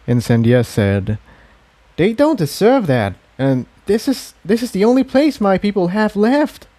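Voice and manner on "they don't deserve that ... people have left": put-on higher, earnest young mare. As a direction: plead for your people, voice lifted. Incendia defends her people.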